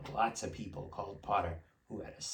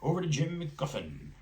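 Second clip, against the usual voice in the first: amused newsreader; 6 semitones higher, 5 dB louder than usual. A narrator's reading. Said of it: "allowed himself a grin".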